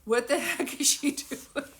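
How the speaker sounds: slow words breaking into real laughter; laughing. Real, not acted. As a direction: lose it while quoting the question. Cracks up repeating brother's line.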